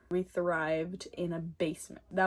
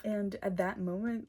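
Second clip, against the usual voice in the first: ordinary talk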